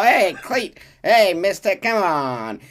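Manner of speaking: put-on high, loud, eager kid voice; cheeky street kids. Street kids hustling Arthur into a tour, high and loud. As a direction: sell the tour like a scheming urchin.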